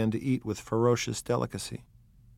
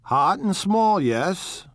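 Wolfsheim; 11 semitones higher, 6 dB louder, much slower than usual. Acted. Wolfsheim agrees.

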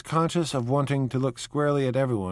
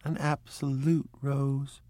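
slow, higher echo; Miss Baker echoing; 5 semitones higher, slower than usual. A narrator's reading. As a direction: drawl it back slowly. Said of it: "turned to Miss Baker for confirmation".

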